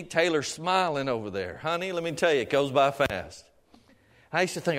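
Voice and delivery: usual speaking voice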